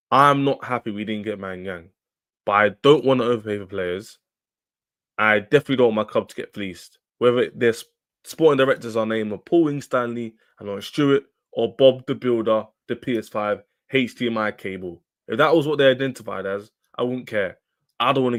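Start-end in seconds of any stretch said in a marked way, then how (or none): none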